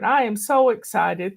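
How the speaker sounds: ordinary talk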